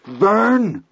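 high accusing cry of judgment; accusing shout. A narrator's reading. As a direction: call him out like doomsday. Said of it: "in a terrible, accusing ... right early voice".

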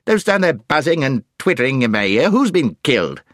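high, irritable scolding; crotchety deaf dwarf. A narrator's reading. Deaf dwarf scolding the owl for twittering, crotchety.

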